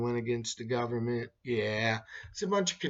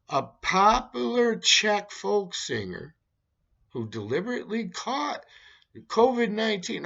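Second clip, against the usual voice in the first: edging into sarcasm; 8 semitones higher than usual. Acted. Reading a news headline to viewers, half-sarcastic. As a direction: read the headline with a sarcastic lilt. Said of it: "I'm on the verge of serious news going into sarcasm".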